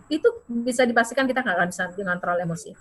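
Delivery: normal talking voice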